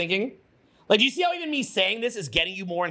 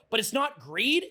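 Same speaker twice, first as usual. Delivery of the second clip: ordinary delivery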